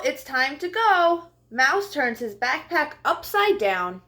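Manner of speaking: bright, high storybook read-aloud; lively picture book voice for young listeners; reading to children. Acted. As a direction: read it like story time for little kids. Reads picture book to kids.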